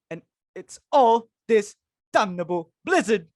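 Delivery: ordinary delivery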